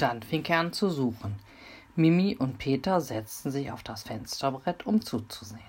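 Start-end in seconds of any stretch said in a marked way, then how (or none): none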